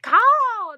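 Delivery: loud high bird screech; hawk screech. Shown as a demonstration. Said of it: "That's what it is".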